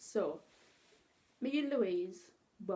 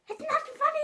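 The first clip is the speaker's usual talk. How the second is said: whiny protest; 6 dB louder, faster than usual. Retold or imitated. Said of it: "and she was like".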